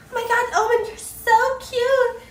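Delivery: high-pitched fangirl squeal; mock smitten gamer girl, very high; gushing girl. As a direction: gush in a loud, put-on girly voice. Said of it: "and the girl's like".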